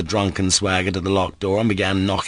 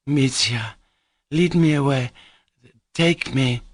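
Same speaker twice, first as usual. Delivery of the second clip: higher, helpless pleading; 7 semitones higher than usual. A narrator's reading. Grushenka helplessly asking Mitya to carry her away. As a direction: reach for him, spent and helpless. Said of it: "said Grushenka helplessly".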